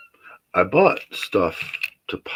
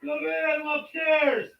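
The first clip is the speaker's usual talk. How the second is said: high-pitched call from upstairs; yelling across the room. Real, not acted. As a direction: call out down to the door. Zach knocking on the door downstairs.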